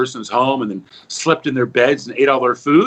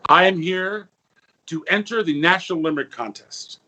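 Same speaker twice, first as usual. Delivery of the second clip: usual speaking voice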